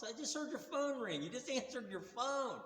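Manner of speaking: high-pitched retold protest; incredulous accusation. Acted quarrel, catching him hiding.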